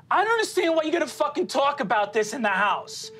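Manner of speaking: a mother fed up, voice up high and pleading; upset mom. Acted. Mother scolding her sons about business at home, high and exasperated.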